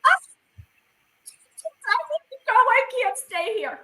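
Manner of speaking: high, crying plea to leave; tearful panic. Retold or imitated. Overwhelmed at the bath, she begs the women in a high crying voice. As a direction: break down and beg to get out. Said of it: "I was like".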